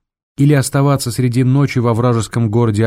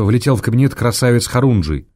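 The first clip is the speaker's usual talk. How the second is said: usual speaking voice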